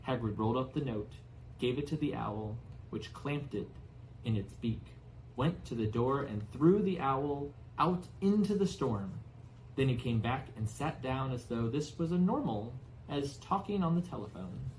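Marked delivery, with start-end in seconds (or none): none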